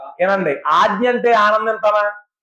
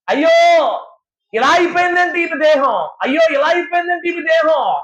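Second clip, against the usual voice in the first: wailing lament; 6 semitones higher than usual. Acted. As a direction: cry out in grief over her body. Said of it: "అని ఏడుస్తుంటే".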